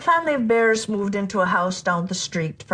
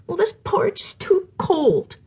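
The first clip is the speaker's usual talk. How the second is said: put-on higher whispered little girl; girl's whisper; 8 semitones higher than usual. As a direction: whisper it like a fussy small girl. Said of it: "she said".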